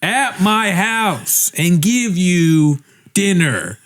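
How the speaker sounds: very slow, high mock proposition; exaggerated come-on. Co-host imagines her offer to his friend, slow and exaggerated. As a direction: stretch every word out, teasingly overblown. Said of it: "what if it was even more like".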